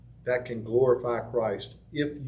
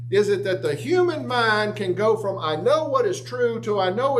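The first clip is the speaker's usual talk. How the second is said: fired-up preaching; 8 semitones higher than usual. Acted. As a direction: preach it with rising fire, voicing the crowd. Heated preaching.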